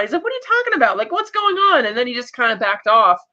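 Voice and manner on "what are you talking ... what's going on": high-pitched pressing questions, acted out; mock incredulous pushing, much higher; insistent questioning. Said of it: "he was pushing her kinda hard".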